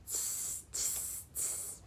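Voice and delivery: soft, voiceless hissed "tss"; three quiet teasing "tss" sounds; monkey tss noises. Acted. Monkeys answering the peddler, quiet mocking tss.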